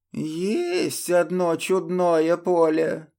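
высокий, медленный стариковский голос; кряхтеть как дряхлый дед; кряхтящий старик. Acted. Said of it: "прокряхтел дед". (кряхтящий дед)

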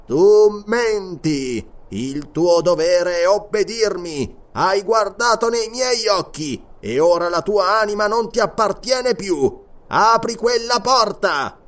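slow, high, loud hypnotic command; commanding mesmerist voice. Acted. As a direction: bend his will with every word. Mesmerist commands entranced guard.

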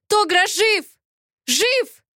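very high, urgent outcry; shrieked-up pitch, moderate volume; desperate cry. A narrator's reading. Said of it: "закричала я".

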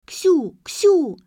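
high, loud little-sister call; urgent high-pitched shout to wake someone; child's treble. A narrator's reading. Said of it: "разбудил дисконт Леры".

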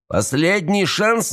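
high, drawn-out taunting call; menacing warning. Acted. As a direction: stretch it out high as a threat. Бандит угрожает через дверь.

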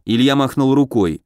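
ordinary delivery, nothing marked

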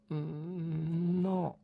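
weakly. A narrator's reading. Wife answering her husband faintly, soft and weak. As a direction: barely get the words out. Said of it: "rispose debolmente Dora".

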